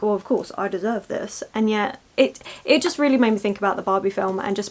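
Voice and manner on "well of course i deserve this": easy, unbothered "of course I deserve this" trailing softer; smug acceptance. Retold or imitated. Vlogger imitating others accepting praise, smug. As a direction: take the praise like it's owed. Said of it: "They just like".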